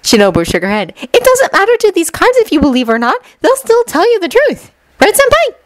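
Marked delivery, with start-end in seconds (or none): 1.0-5.5 put-on very high-pitched, girlish insistence; earnest young girl, hopeful. Shinobu defends her cards and appeals to senpai, high and earnest.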